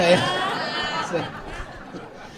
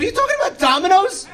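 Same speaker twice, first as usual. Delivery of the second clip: furious disbelief at the venue guy; exasperated rant; 8 semitones higher than usual. Retold or imitated. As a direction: blow up at the guy on the line. Frustrated call to the venue.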